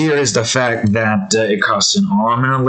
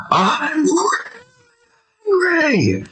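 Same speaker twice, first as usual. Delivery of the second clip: high, cracking yell; mock rage outburst, much higher; shouting; slower than usual. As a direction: burst out screaming it. Yells after the whispered version.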